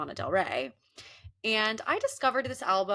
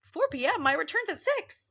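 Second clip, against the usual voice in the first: incredulous; 10 semitones higher than usual. Retold or imitated. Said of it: "And I'm like".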